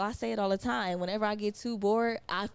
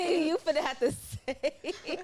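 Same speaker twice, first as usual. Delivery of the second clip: high, drawn-out laughing words; real laughter breaking into speech; 7 semitones higher than usual. Real, not acted. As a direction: laugh through the joke, stretching it out. Joking with friend while laughing.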